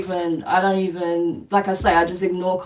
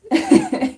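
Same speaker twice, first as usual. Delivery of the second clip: high-pitched giggle; self-mocking laugh. Real, not acted. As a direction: giggle at your own failed attempts. Laughs at own failed Spanish.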